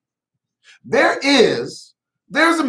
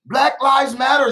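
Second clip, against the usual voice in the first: ordinary talk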